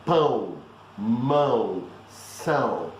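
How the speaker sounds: pão, mão, são, each nasal and low; nasal ão drill. Shown as a demonstration. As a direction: model the three words slowly.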